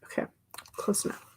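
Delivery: whispered aside. Real, not acted. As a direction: breathe it under your breath. Muttered to herself.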